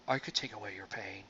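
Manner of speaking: soft, low imitation of Sybok; lightly voiced offer to take away pain; Star Trek Five quote. Quoting Sybok offering to heal, soft low voice. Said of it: "good line out of Star Trek Five".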